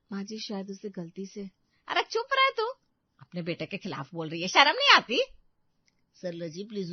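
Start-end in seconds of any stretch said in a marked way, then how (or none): 1.9-2.7 much higher, loud scolding; angry snap. Acted. Grandmother silences her daughter-in-law with a high, loud scolding. 4.5-5.2 higher, much louder rebuke; angry scolding. Acted. Grandmother scolds her daughter-in-law for speaking against her son, loud.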